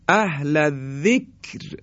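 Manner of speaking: اهل الذکر slowly, ending on a thin R; light R. Shown as a demonstration. (اهل الذکر با «ر» آخر نازک)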